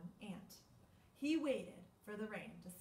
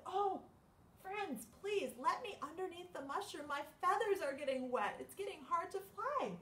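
higher pleading bird; 8 semitones higher than usual. Acted. Bird pleads with friends, lightly raised pitch. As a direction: ask like a wet little bird. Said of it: "and bird said".

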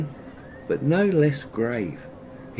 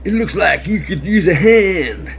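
bear voice; 6 semitones higher, 8 dB louder than usual. Acted. Bear's first line in story.

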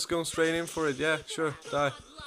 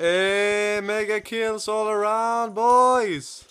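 louder, slower, drawn-out hype call; hyped cheer; 3 semitones higher, 6 dB louder, much slower than usual. Real, not acted. Gamer calls out mega kills to friends, hyped.

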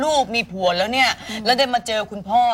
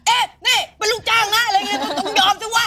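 mock shop owner; 10 semitones higher than usual. Owner's side of the quarrel.

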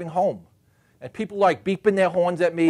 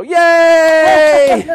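long, high, loud cheer; mock puppy praise; 10 semitones higher, 12 dB louder than usual. Husband cheering wife's bathroom trip like a puppy's, exaggerated excitement. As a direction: whoop it out and hold the vowel. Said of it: "every time my wife goes to the bathroom".

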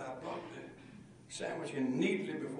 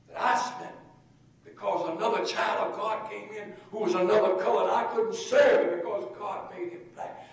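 impassioned confession; 4 semitones higher, 13 dB louder than usual. Retold or imitated. Waitress confesses to the preacher, impassioned and loud. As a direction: let the burden spill out.

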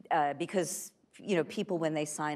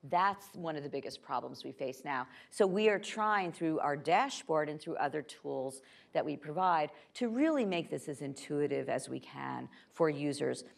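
usual speaking voice

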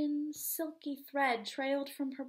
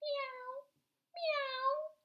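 cat meowing; 13 semitones higher than usual. Acted. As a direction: meow like a little cat. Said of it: "cried the cat".

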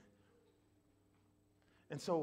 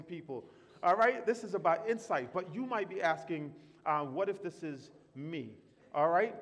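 louder, climbing preacher's warning; full-force preaching; 6 semitones higher, 10 dB louder than usual. Acted. Pastor warning the church not to diagnose others, preaching loud. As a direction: drive it home to the congregation.

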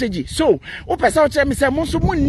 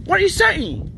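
challenging; 8 semitones higher than usual. Real, not acted. Challenging a critic.

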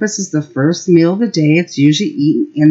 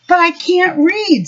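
put-on worried little boy; 12 semitones higher than usual. Worried child Henry voiced.

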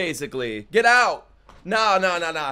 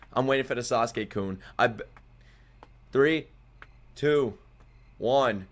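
normal talking voice

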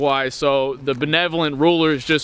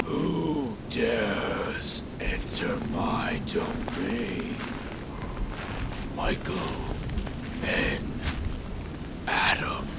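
slow booming whispered Overlord; dragon voice; 6 semitones lower, 12 dB quieter, much slower than usual. Acted. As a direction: speak like a looming dragon god, slowly. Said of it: "the Overlord".

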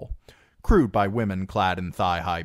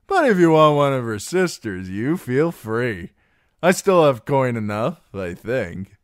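loud, high, boozy offer; tipsy, jovial swagger; generous drunken singer; 6 semitones higher, 8 dB louder than usual. Acted. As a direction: play the expansive merry drunk. Plays Darion the drunken singer.

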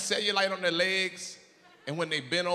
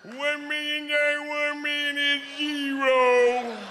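put-on high slow childlike voice; 11 semitones higher, much slower than usual. Voicing girlfriend in bed.

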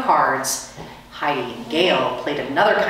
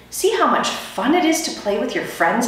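high, fast little squeaky voice; squeaky hamster voice; 8 semitones higher, faster than usual. A narrator's reading. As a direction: ask it in a tiny rodent squeak. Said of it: "I asked".

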